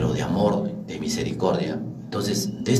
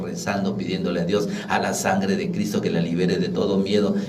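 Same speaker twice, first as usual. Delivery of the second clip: normal talking voice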